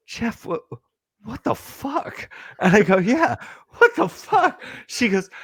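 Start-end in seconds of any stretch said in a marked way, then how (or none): 0.1-2.3 hushed, high-pitched disbelief; halting, softly squeaked astonishment; retold shock. Shocked sister rolls up. 3.0-4.6 high-pitched incredulous echo; acted-out disbelief, pitched way up; retold bewilderment. His stunned agreement, retold.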